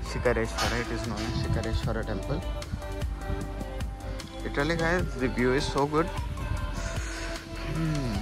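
softly spoken temple tour; hushed commentary. Real, not acted. Hushed commentary while visiting Shiva temple.

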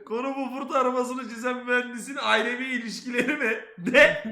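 laughing through the question; disbelieving laugh. Real, not acted. Repeats clip while laughing.

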